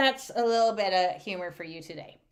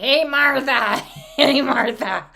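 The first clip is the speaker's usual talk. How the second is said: loud mock farmer call; brash, hammed-up shout; hollering husband; 3 semitones higher, 8 dB louder than usual. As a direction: holler for the wife, half laughing. Husband hollers at wife again.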